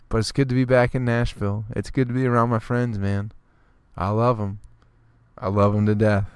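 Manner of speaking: usual speaking voice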